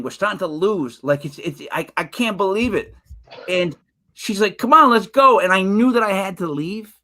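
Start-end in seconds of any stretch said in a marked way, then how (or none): 4.6-5.4 impatient urging. Retold or imitated. Wife urging him to leave.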